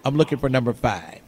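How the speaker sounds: ordinary talk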